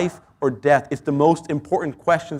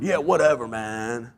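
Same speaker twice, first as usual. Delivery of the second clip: lightly voiced lazy surfer dude, low; a hint of a shrugging surfer; 6 semitones lower, 6 dB quieter than usual. Preacher mocking a carefree surfer, drawling lightly. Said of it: "Like the surfer dude".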